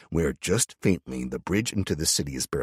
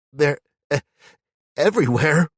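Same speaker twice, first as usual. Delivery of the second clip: tearful; 12 semitones higher, 9 dB louder than usual. Acted. Cameron crying.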